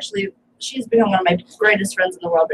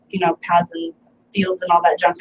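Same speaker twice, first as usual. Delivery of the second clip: usual speaking voice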